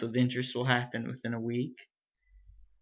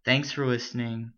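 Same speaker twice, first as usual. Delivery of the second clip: normal talking voice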